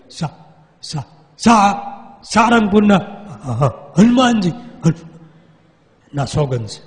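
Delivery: broken stammer on સા- and હ- before સારંગપુરના; stuttering struggle. Retold or imitated. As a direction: fight to get the name out. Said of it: "આટલું નામ નહોતો બોલી શકતો".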